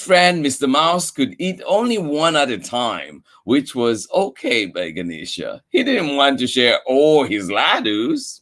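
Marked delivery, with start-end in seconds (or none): none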